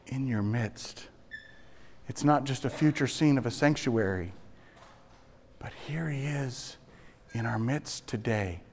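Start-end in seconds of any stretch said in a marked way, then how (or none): 5.6-8.6 whispered, intimate; hushed whisper. Acted. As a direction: drop to a reverent whisper. Hushed sermon moment.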